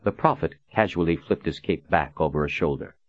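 ordinary delivery

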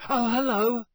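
very high, loud squeak of greeting; unnaturally high. A narrator's reading. Shaken boy greets his friends, unnaturally high. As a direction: pretend all is well in a much-too-high voice. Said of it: "his voice much higher than usual".

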